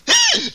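sharp high hiccup gasp. Acted. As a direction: gulp in a squeaky hiccup. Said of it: "I got the hiccups".

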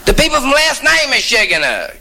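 high-pitched, sustained caller's voice; Schechner calling. Acted. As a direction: explain who's calling in a high put-on voice. Caller explains who is phoning.

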